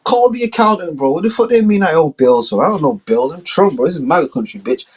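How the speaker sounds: high, brash ranting; drunk mock Trump. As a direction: rant like a drunk big shot. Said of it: "He's like".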